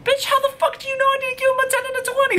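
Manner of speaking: very high, fast screamed challenge; furious screech. Retold or imitated. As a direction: shriek it in outraged disbelief, all in one rush. Her outburst at the denying manager.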